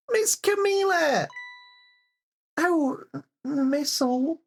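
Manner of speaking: put-on worried small girl, voice up high; a high, anxious child calling her friend; high little girl voice. Game character voiced in turns.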